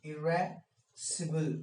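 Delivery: इरेसिबल said slowly, each syllable clear as i-RA-si-bul; teacher stretching out इरेसिबल for the class to repeat; slow model pronunciation. Shown as a demonstration. Teacher models इरेसिबल slowly for the class to repeat. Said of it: "बोलिए इरेसिबल".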